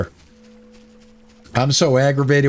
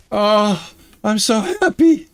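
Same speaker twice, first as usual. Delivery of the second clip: much higher, delighted squeal; overjoyed; 7 semitones higher than usual. Real, not acted. Streamer reunited with his lost ship, high delighted gush. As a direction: gush with relief and joy.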